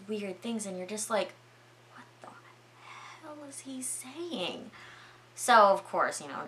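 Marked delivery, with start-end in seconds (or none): 1.9-4.7 baffled near-whisper. Retold or imitated. Storyteller voices a baffled viewer, hushed disbelief. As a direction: whisper it, baffled, rising on the last words.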